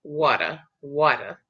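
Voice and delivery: "Water" as wah-der with R; their way with R and D. Shown as a demonstration. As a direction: flap the T and sound the R in "Water". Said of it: "And they add a D".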